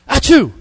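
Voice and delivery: loud, high fake sneeze; acted-out sneeze; mock sneeze. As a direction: burst out with a pretend achoo. Acts out someone sneezing.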